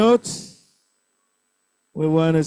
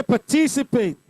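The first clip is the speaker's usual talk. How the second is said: participate, raised on "tic"; stress on second syllable; 3 semitones higher than usual. Shown as a demonstration. Teacher has class repeat the word, participate stressed on "tic". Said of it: "Second syllable".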